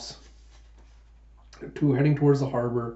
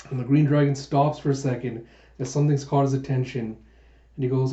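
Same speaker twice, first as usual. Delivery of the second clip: usual speaking voice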